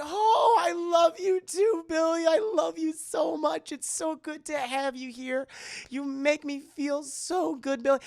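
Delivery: high, slow, tearful-sounding gush; hammed-up emotional grandmother; gushing grandma. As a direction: overflow with love for a lost grandchild. Grandmother moved by spirit's message.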